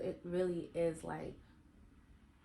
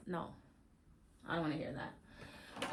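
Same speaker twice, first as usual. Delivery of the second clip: soft refusal; 3 semitones lower, 7 dB quieter, slower than usual. Real, not acted. Rejecting the cliche.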